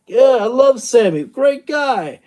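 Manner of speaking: lightly hammed-up wrestler praising his rival; mock gushing wrestler. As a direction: toss it off like a fake-cheery press answer. Mock wrestler's upbeat press answer.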